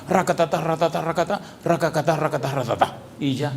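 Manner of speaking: mock rattled-off prayer. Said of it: "¿Le gustaría que alguien le hable así?".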